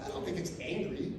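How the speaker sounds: soft, low, understated denial; mock innocence. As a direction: play it down quietly and innocently. Playing innocent about his angry email.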